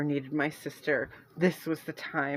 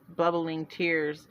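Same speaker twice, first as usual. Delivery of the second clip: plain reading voice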